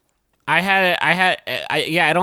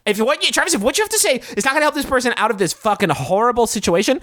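higher, fast mock-furious rant; pitched-up, rapid mock scolding; mock outrage; 8 semitones higher, faster than usual. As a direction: rant at him, hammed-up indignation. Scolding Travis in bit.

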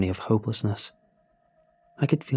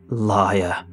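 louder accusing witch shout; witch scream; 3 semitones lower, 4 dB louder than usual. Acted. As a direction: spit out the accusation at the liar. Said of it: "she screamed".

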